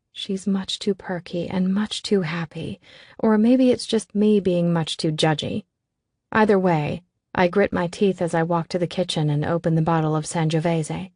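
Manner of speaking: usual speaking voice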